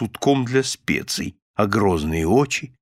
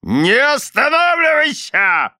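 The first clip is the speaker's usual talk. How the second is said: high, drawn-out stern command; stern shout; 13 semitones higher, 4 dB louder, slower than usual. A narrator's reading. Husband keeping wife reciting, shouting sternly. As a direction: cry out the order, stretching it. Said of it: "строго прикрикнул мистер Корнер".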